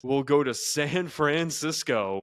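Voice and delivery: slow, drawn-out matchup reveal; teasing announcement. Pretend.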